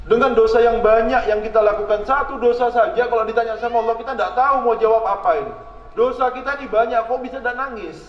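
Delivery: high, impassioned sermon voice building in force; pleading preacher's cry; fervent preaching. Acted. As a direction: preach it with rising urgency. Preacher rising to fervour.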